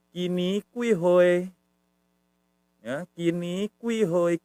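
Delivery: high, slow pronunciation demo; language model. Shown as a demonstration. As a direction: stretch each syllable for learners. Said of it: "Bahasa Thainya gimana?".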